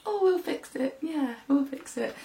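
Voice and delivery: a soft, slowed reassurance in mum's voice; mum reassuring. Retold or imitated. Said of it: "my mum come down and she was like".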